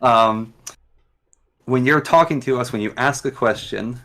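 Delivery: normal talking voice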